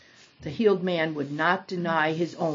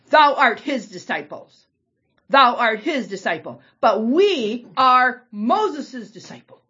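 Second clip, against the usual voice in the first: scornful; 5 semitones higher, 6 dB louder, slower than usual. Acted. Pharisees reviling the man.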